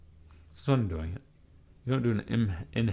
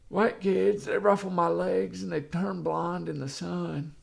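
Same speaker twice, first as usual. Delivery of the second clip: mock politician voice, pitched up; Joe Biden; 7 semitones higher than usual. Mocking the president as a pervert, high impression voice. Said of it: "Oh, and Joe Biden".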